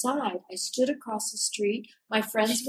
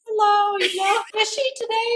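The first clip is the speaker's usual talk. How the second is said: much higher, louder, laughing put-on voice; mock chirpy greeting; 12 semitones higher, 4 dB louder than usual. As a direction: echo the shopkeepers' chirpy tone back at them. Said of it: "And I'd be like".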